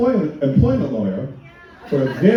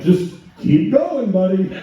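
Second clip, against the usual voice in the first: mock encouragement; 8 semitones higher than usual.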